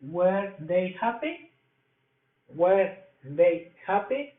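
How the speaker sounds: Were they happy? slow, with a rise on happy; slow model question. Shown as a demonstration. Teacher models the English question for the students. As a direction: say it slowly and lift happy. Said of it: "Para este ejemplo sería".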